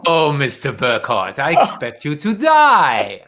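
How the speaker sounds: slow, hammed-up villain with drawn-out menace; Bond villain. Host answers guest's Bond line as the bad guy, villain voice. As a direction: play the gloating bad guy, stretching the last word. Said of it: "I'm the bad guy".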